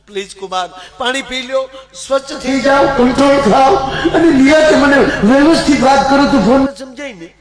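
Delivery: calm him down like a fussing mother; soothing mother-in-law. Acted. Mother-in-law calms the son-in-law. (ઊંચા અવાજે સમજાવતી સાસુ)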